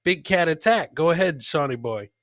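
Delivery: ordinary delivery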